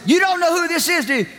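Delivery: high, teasing question; woman on the phone teasing the preacher; playful woman caller. Retold or imitated. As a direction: tease him in a high woman's voice. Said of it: "finally said".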